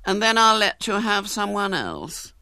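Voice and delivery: a lightly voiced stately dancing mistress. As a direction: soothe the girl like a grand teacher. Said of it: "said Missus Beauchamp".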